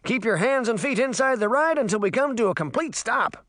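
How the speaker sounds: like a carnival ride announcer; ride operator shout. A narrator's reading. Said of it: "he shouted".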